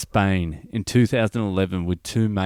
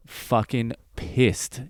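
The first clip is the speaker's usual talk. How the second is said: slow emphasis; 4 dB quieter, much slower than usual. Shown as a demonstration. Host demonstrating Aussie slang slowly to listeners. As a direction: drag "Fucking pissed" out slowly and a bit softer. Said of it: "for our international listeners".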